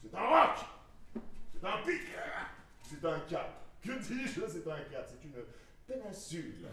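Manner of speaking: rising list, roc, pic, cap; descriptive, building. Acted. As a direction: build each image bigger.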